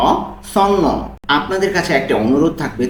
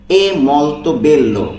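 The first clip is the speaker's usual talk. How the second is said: È molto bello stretched out slowly for learners; slow careful pronunciation; 3 dB louder, much slower than usual. Shown as a demonstration. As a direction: lay out È molto bello word by word. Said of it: "এই উচ্চারণগুলো মনোযোগ সহকারে শুনবেন".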